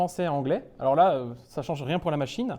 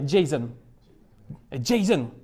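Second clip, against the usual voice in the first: imitating an English speaker saying it; quoted English accent; English pronunciation; 4 semitones lower, slower than usual. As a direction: say it the English way, JAY-son. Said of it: "qui parlait anglais et qui arrêtait pas de dire".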